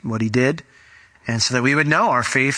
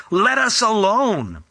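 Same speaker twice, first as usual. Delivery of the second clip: very slow, higher drawn-out plea; lightly voiced demon crying out; demon's cry; 6 semitones higher, slower than usual. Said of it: "cried out with a loud voice".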